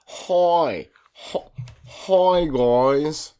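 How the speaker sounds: high, loud mock Australian greeting; put-on Australian accent. Podcast host greeting listeners in a silly Australian accent. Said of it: "I'm gonna talk with this accent".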